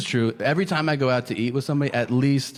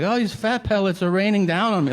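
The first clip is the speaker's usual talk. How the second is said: mock lament; 6 semitones higher, 6 dB louder than usual. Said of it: "It's not like weather where I'm like".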